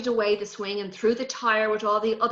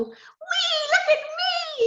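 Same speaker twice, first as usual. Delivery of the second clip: put-on much higher, gleeful child on a swing; excited whee; 4 dB louder, much slower than usual. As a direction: squeal with joy as you fly up.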